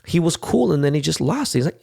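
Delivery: usual speaking voice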